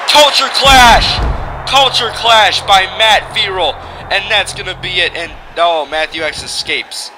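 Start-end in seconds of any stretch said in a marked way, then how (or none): 0.1-3.7 booming slow announcer call; drawn-out announcer shout; ring announcer. Acted. Names the finishing move.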